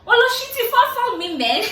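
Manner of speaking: high, loud mock yell in Yoruba; mock shout. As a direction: shout it like the furious husband. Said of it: "the idiot started shouting".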